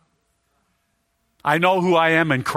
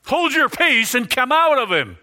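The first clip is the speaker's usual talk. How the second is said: sharply higher, forceful command to the demon; commanding yell; 8 semitones higher than usual. Shown as a demonstration. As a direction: order it out like casting a demon out. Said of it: "I don't know if he yelled".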